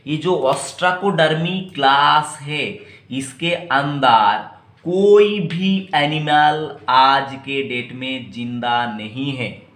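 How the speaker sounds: ordinary talk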